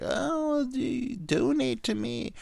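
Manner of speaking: high, slow, breathy-free sweet plea, mocking; mock girly voice. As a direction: imitate a hot girl asking for donations, high and drawn out. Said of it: "and they're like".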